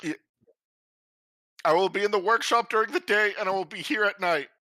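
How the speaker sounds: on the verge of tears. Acted. Claiming the workshop and lookout, mildly tearful. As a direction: hold back happy tears, pitch a little up. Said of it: "Are you crying?".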